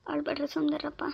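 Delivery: soft, half-whispered soothing; hushed consoling. Acted. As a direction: hush crying children gently. Grandmother hushing crying children.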